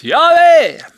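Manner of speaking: high, booming call; shouted cry. Acted. Preacher showing how God calls out his name, high loud shout. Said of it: "og så roper du ut".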